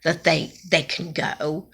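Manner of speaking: soft, hushed aside; whispered. Retold or imitated. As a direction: say it under your breath. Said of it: "the hospice worker whispered to me".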